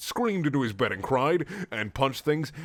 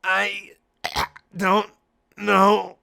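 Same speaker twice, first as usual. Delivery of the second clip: higher frail voice with a cough; crackling sick voice; 9 semitones higher, 7 dB louder, much slower than usual. Acted. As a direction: splutter it out sickly. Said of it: "with a crackling sounding voice".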